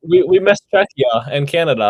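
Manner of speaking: high, loud laughing burst. Real, not acted. Cohost laughs, noticing missed teams, laughing. Laughing while spotting a mistake.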